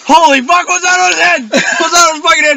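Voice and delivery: high, loud startled outburst; shocked exclamation. Retold or imitated. Retelling a bull encounter, shocked and loud. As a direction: blurt it out in disbelief.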